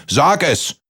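short impatient mock roar, high; roaring giant. A narrator's reading. Giant presses Kaspar for an answer, high bellow. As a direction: push it out as an urgent demand. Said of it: "brüllte der Riese von oben".

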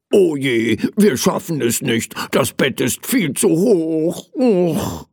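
dejected teddy, voice rising as it goes; sad. Acted. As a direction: lament it with a mild groan of defeat. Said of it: "meinte Knuffel traurig". (traurig jammernd)

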